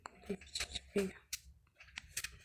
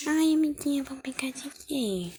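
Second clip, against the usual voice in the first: doll voice; 6 semitones higher, 8 dB louder than usual. Pretend. Playing a doll talking to a friend.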